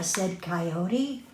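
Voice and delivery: plain reading voice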